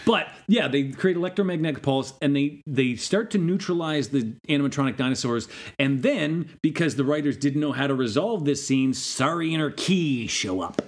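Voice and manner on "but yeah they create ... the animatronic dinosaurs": normal talking voice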